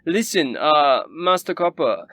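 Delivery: high, loud snooty noble; a pompous rich lady fawning on a guest; Rabaya's voice. Acted. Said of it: "Rabaya says".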